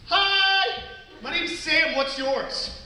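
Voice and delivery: put-on high, eager kid introducing herself; friendly little girl. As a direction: play an outgoing small girl. Voices four-year-old daughter meeting kid.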